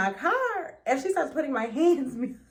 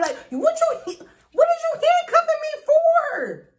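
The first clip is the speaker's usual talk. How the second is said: much higher, laughing indignant protest; outraged disbelief; 12 semitones higher, 5 dB louder than usual. Retold or imitated. Protesting the handcuffs to the officer, high laughing outrage. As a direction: protest it like it's absurd.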